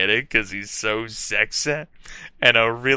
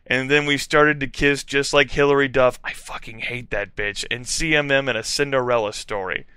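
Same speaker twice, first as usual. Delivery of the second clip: usual speaking voice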